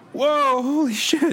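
loud high startled yell; surprised shout. Acted. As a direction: shout as the wheel spins. Ferris wheel spinning.

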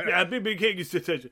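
light mock Southern twang; mock bayou accent. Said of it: "back in the bayou".

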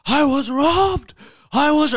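high, loud, slow panicked wail; frantic shout down the phone; panicked screaming. Retold or imitated. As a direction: cry out that you've been robbed. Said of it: "he's screaming".

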